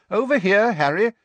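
calling out. Acted. Mr Weasley calling Harry over.

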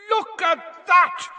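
high, slow excited yell. A narrator's reading. Commentator yelling to the crowd, high and excited. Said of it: "Bagman was yelling".

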